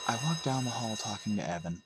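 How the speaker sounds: plain reading voice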